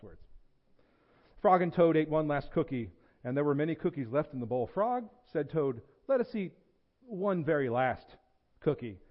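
animated read-aloud with lifted character lines; story reading. A narrator's reading. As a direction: tell it like a children's picture book. Reads a children's story to congregation.

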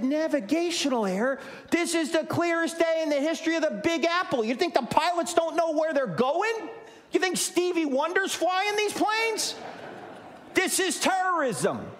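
high, scornful disbelief; mock the commentator, voice way up; incredulous scoffing.

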